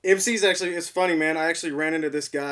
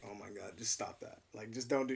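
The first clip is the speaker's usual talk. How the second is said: hushed, much softer groan at his wife; soft mortified protest; embarrassed plea; 6 semitones lower, 13 dB quieter than usual. Retold or imitated. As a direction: beg her quietly to stop teasing. Retelling telling wife to stop.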